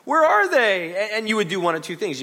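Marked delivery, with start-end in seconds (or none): 0.0-1.0 high, loud mock amazement; shocked gasp. Audience member shocked at the magic show, high and loud. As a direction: act stunned by the vanished person. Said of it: "You'd be like".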